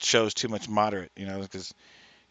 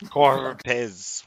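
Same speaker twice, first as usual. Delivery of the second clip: Cortez with a heavy rolled R, anchor style; rolled newscaster R; 3 semitones higher, 6 dB louder than usual. Pretend. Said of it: "Like five little R's".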